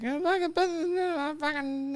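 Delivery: high mock rant trailing off; mock flustered wife. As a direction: sputter like the upset wife. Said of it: "she was like".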